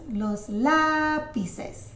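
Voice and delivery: "lápices" with a high, drawn-out first syllable; slow, emphatic accent on the start of "lápices"; stress on accented syllable. Shown as a demonstration. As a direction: lift and stretch the "lá" in "lápices". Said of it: "When you see an accent".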